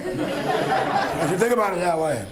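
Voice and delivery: retold line broken up by laughter; laughing while retelling. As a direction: chuckle through the quote, amused. Retelling punchline, laughing through it.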